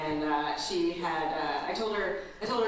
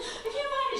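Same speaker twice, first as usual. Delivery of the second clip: put-on much higher whiny child voice; little daughter. Mom imitating her daughter, much higher child voice. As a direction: play the panicked little girl. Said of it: "And she's like".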